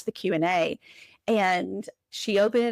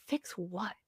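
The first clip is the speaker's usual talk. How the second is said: quiet; 12 dB quieter than usual. Retold or imitated. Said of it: "I was like".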